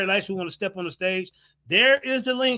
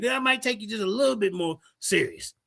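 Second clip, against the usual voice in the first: normal talking voice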